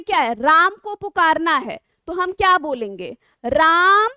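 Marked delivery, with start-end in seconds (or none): 3.4-4.1 calling राम from afar, vowel drawn out and louder; long calling out. Shown as a demonstration. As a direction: stretch the आ in राम like a call to someone far off. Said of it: "जैसे मान लीजिए हम किसी को पुकारते हैं".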